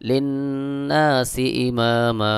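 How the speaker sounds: "ইমামা" ending on a long open a, no n; one-alif stretch at pause. Shown as a demonstration. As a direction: slowly chant "লিন্নাসি ইমামা", stretching the final vowel. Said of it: "এক আলিফ টান দিতে হবে".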